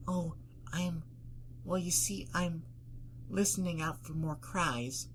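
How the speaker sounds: high, hesitant pony voice; timid Fluttershy. Acted. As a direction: speak high and haltingly, feeling for the words.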